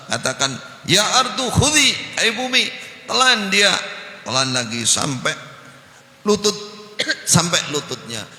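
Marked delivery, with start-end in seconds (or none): 0.8-3.7 furious shout. Acted. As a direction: roar at the earth to swallow him. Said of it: "tetap marah besar". (teriakan marah yang keras dan tinggi)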